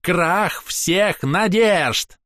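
very slow, high, drawn-out wail; theatrical lament. A narrator's reading. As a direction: stretch every word theatrically. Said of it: "картинно ... запрокидывая голову".

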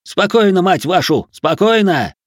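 loud, high-pitched bark; forceful, angry command; roared order. A narrator's reading. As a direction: bellow at the crowd for calm. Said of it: "взревел Герман".

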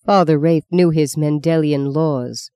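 usual speaking voice

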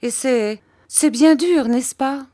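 very high, pleading pout; pouting protest. A narrator's reading. Mother seeking her husband's sympathy, pouting protest. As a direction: insist sulkily, pitch lifted high. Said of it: "en faisant une petite moue".

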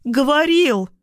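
high, insistent shriek; squeal. A narrator's reading. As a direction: squeal the accusation. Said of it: "визжит она". (визгливый выкрик)

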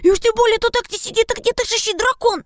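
very high, loud panicked squeak; squeakiest. Acted. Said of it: "С каждой фразой его голос становился всё писклявее".

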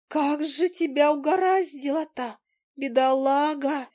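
high, slow tiny voice full of pity; a little fly clucking over the poor guy. Acted. The fly pitying Willy in the crack, high and slow. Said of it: "Мушка забегала".

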